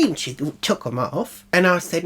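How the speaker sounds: normal talking voice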